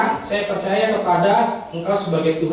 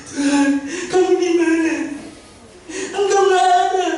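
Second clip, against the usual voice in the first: hammed-up tearful calling, pitched high; acted crying plea; 10 semitones higher than usual. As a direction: cry out as if abandoned, play-acted. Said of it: "Terus saya nangis".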